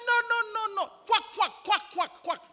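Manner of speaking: high, insistent denial into duck quacks; frantic clarification. Retold or imitated. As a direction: imitate someone urgently correcting a misunderstanding. Student hurriedly explains duck with quacks.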